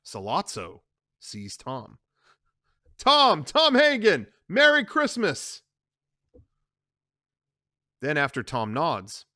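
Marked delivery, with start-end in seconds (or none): 3.0-5.6 high, loud, slow greeting; drawn-out greeting. Acted. Sollozzo hailing Tom in the street, slow and loud. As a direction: call out slowly to Tom.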